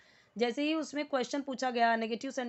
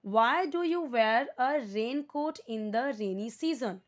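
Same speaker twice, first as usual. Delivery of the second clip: very slow, word by word English sentence; slow model reading; 3 dB louder, much slower than usual. Shown as a demonstration. Teacher reading the question aloud for Hindi-speaking students, very slow. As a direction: read it out slowly so students can follow. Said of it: "अब ध्यान से सुनिए कि इस word को कैसे बोलना है".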